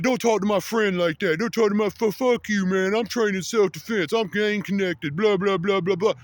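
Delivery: high-pitched, hammed-up drunk threats; mock belligerent kid. Retold drunk kid confronting him.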